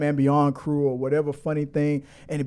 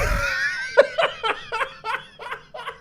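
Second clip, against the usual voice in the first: big laughing shriek. Real, not acted. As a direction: crack up completely. Laughing after the mind-blown moment.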